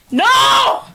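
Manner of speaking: high, loud cry of No; a real scream of horror; horrified scream. Real, not acted. Horrified at the execution.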